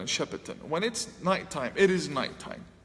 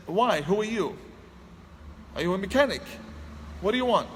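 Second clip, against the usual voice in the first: defensive; 4 semitones higher, faster than usual. Retold or imitated. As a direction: push him away with each question. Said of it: "Just very defensive".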